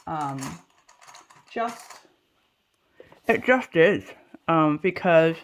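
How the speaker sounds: talking round a clip; words muffled by a clip in mouth; mouth full. Real, not acted. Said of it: "had a clip in my mouth".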